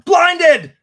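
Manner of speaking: a sudden, high, loud shout; shouted reveal. Acted. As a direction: blast it out like a big reveal. Blinding viewers with neon slides.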